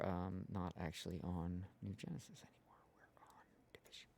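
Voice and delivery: muttered under the breath; whispering. Real, not acted. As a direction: whisper to yourself while busy. Whispers while fixing stream title.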